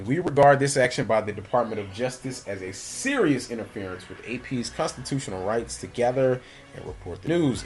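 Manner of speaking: ordinary delivery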